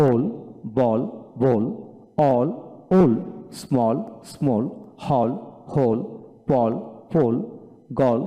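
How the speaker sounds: isolated words, open American vowel then rounded British one; two-accent word drill. Shown as a demonstration. Teacher drills word pairs for learners, two pronunciations. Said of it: "এইভাবে দুইটা উচ্চারণে পড়াবেন".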